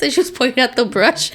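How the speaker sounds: ordinary delivery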